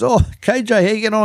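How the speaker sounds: high, chirpy mock call of a mate from the commentary box; mate up in the box calling down to the sideline reporter, chirpy and high; imitating Steve Gordon. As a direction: play the cheery co-commentator checking in from up top. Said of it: "And Steve Gordon goes".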